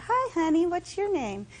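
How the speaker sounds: put-on high, sweet baby talk; a man leaning over to chat with a nursing baby, cooing. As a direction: coo to the little one like a friendly stranger.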